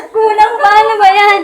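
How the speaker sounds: high, laughing whine of not enough; laughing mock complaint. Pastor mock-complaining to God, laughing.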